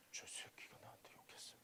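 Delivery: whispered, wounded complaint; a child whispering that he was sworn at; hurt whisper. Retold or imitated. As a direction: whisper it like a hurt kid telling on someone.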